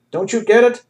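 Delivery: high, loud, frantic shout; shouting after him. A narrator's reading. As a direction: yell it after the man walking away. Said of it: "Heckholler shouted after him".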